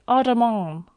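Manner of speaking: high, loud call; girl calling back. Acted. Valentine calling goodbye to the painter, a high bright call. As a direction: call a goodbye across the street. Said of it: "she cried".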